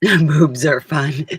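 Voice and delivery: slow, drawn-out words through real laughter; laughing it out slowly. Real, not acted. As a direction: giggle the punchline out slowly. Laughing punchline.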